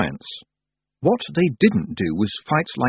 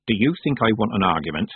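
usual speaking voice

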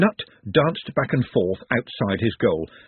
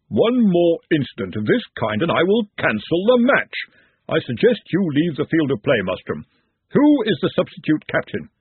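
a hint of a stern official; stern threat; 6 semitones higher than usual. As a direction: lay down the law. Henry threatens to cancel match.

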